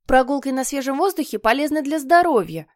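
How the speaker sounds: put-on prim obedient boy. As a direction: lecture like a goody-goody younger brother. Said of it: "сообщил послушный Питер".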